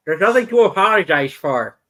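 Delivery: with an overbite lisp; lisping through long teeth. Acted. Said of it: "your next line must be with a lisp".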